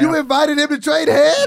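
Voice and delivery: loud, high, drawn-out disbelief; incredulous outburst. Real, not acted. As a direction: cry out in amused shock. Friend amazed at the fight challenge.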